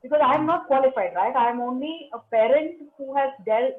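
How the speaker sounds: usual speaking voice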